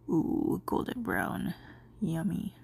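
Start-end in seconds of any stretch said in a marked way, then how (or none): none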